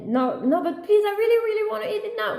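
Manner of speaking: put-on high, insistent begging kid; pleading child. Son begging mom to eat the egg now, pleading child. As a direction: plead like a kid who can't wait.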